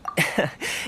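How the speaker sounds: airy amused chuckle. Acted. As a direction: chuckle knowingly. Thoma chuckles.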